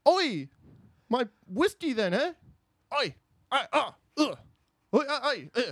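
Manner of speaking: Irish drinker with yelps and grunts; bad Irishman. Second audition as Bad Irishman, high botched Irish accent. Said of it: "I'm reading for the part of Bad Irishman".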